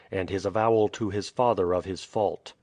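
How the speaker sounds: plain reading voice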